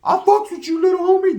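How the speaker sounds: loud, high-pitched mock voice; put-on high voice. Speaker to a little homie, put-on high voice. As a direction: pitch it way up and call it out.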